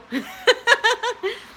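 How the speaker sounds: high burst of laughter; sudden high laugh; bright laugh. Real, not acted. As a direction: laugh out loud at yourself. Laughing at blue everything.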